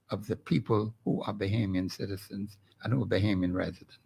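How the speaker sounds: drops to a breathy whisper; sudden unvoiced aside. Real, not acted. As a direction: hush it, almost under the breath. Drops to a whisper mid-point.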